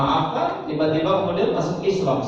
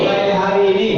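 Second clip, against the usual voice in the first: pledge leader; 6 semitones higher, 8 dB louder, faster than usual. Shown as a demonstration. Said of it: "siap praktek?".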